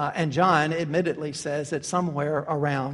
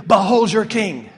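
loud, sincere "Behold your king"; preacher proclaims Christ as king to the congregation, loud and earnest; earnest proclamation; 7 dB louder than usual. Real, not acted. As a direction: declare it to the brothers and sisters. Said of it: "I say to you".

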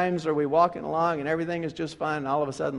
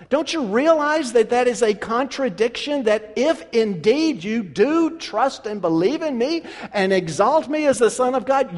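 higher, louder, pressing reproach; stern Jesus rebuke; 7 semitones higher, 7 dB louder than usual. Acted. Jesus reprimanding Peter with love, pressing. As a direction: lay out his error point by point.

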